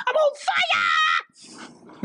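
high-pitched, hammed-up holler; mock cry. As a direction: belt it out like a silly catchphrase. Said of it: "I just brought that up 'cause I wanna say".

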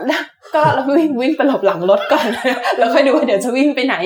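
laughing through the retelling; amused, laughing. Real, not acted. Researcher telling hosts how they fled an elephant, amused and laughing. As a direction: tell your own scramble to safety with a laugh.

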